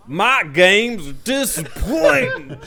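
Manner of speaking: high, drawn-out mock cartoon voice; Alex doing Sonic. Copying a friend's impression, high exaggerated drawl. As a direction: stretch it slow and pitch it high. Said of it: "Alex doing an impression of me".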